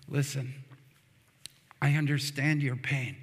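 low, soft, pausing reassurance; gentle comfort. Retold or imitated. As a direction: answer grief quietly, voice dropped low. Voicing Jesus comforting the sisters.

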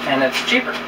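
usual speaking voice

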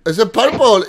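ordinary talk

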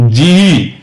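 ਜਿਹੀ said ji-hi, the h audible; two syllables, ਜਿਹੀ, not ji. Shown as a demonstration. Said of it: "ਉਚਾਰਨ ਸੁਣਨਾ, ਜੀ ਨਹੀਂ ਕਹਿਣਾ ਉਹਨੂੰ".

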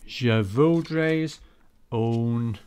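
loud, slow French in a broad Yorkshire accent. Shown as a demonstration. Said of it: "I used to do it in a Yorkshire accent".

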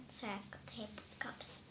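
unvoiced whispered aside. Real, not acted. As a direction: whisper it off-mic. Aside while hippo cups.

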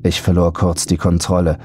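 ordinary delivery

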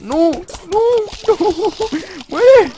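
loud, high cries of dismay; frantic wail at a game going wrong; desperate screaming. Real, not acted. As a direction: scream it out as disaster hits. Screaming as the plant is lost.

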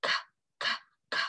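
voiceless "k" clicks, evenly spaced; isolated, repeated "k"; hard C sound. Shown as a demonstration. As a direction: pop out "k" with no vowel. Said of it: "what letter makes this sound".

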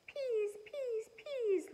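put-on high whispered baby lisp; high breathy toddler "peas"; toddler begging. A narrator's reading. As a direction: beg like a tiny toddler, breathy. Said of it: "Louie said".

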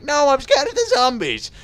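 loud, very high put-on cry; mock frightened protest. Player answering a viewer, exaggerated scared protest. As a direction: wail it like a comic coward. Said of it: "I'm like".

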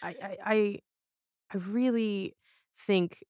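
fighting back tears as the words come out; choked up. Real, not acted. Moved by a guest's abuse story, voice catching near tears. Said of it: "I'm gonna cry".

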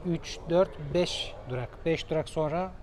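ordinary talk, nothing marked

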